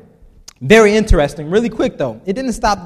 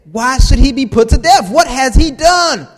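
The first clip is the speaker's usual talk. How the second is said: pleading Jonathan; 5 semitones higher, 6 dB louder than usual. A narrator's reading. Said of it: "in fierce anger".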